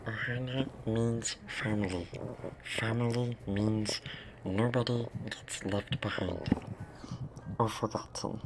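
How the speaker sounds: Stitch. Acted. Asked for an impression, cartoon alien voice. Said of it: "Do a Stitch impression?".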